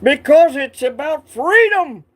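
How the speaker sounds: slow, loud mock Scottish patriot; rally the troops like Braveheart; hammed-up Scottish freedom cry; Braveheart Scottish accent. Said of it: "he sounds like, uh, Braveheart".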